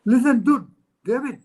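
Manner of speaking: high, loud call into the phone; sudden shout. Retold or imitated. Man on a phone call shouts to his friend. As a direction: bark it out to get his friend's attention. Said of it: "the man next door suddenly shouted".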